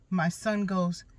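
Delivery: ordinary delivery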